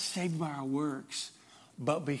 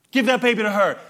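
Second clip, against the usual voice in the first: loud, higher pleading cry; mother crying out; 7 semitones higher, 10 dB louder, faster than usual. Retold or imitated. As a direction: cry out the plea to spare him. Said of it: "the true mother cries out".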